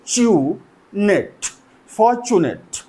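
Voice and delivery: fortunate very slowly as for, chu, net; "fortunate" in slow separate pieces; syllable by syllable. Shown as a demonstration. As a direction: sound out "fortunate" with gaps between syllables. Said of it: "So three syllable हैं".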